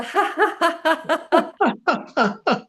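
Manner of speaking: high ha ha released after holding; strong arm laughter; laughing out the breath. Shown as a demonstration. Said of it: "breathe out with the laughter".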